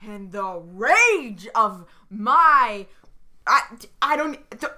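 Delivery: drawn-out, hammed-up rage; furious. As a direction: stretch the words out in acted fury. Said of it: "I am standing and I am yelling".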